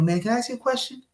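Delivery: put-on high, soft girlfriend voice; imitating girlfriend. Imitating the girlfriend's dreaded question, high and soft. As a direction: lift it into a sweet girlfriend ask. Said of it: "she said".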